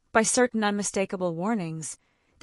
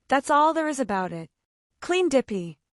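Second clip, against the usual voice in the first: higher, louder gruff insistence; angry; 7 semitones higher, 5 dB louder than usual. A narrator's reading. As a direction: hammer the point home crossly. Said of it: "he snorted angrily".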